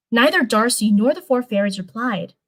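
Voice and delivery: usual speaking voice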